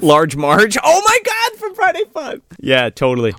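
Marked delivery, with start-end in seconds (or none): 0.8-2.4 very high excited squeal; excited recognition. Real, not acted. Host recognizing an actress from Friday Five, excited squeal. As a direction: squeal it as the memory hits.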